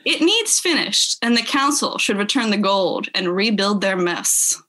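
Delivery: ordinary talk, nothing marked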